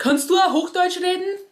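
mock the pushy heckler who sneers at dialect; a put-down tossed from the side, high and scornful; rude heckler. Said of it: "von der Seite anpöbelt und sagt".

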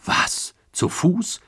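high, startled question; surprised question. A narrator's reading. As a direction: ask in disbelief, pitch jumping up. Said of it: "fragten alle zuhörenden Passagiere im Chor". (verblüfft nachfragen)

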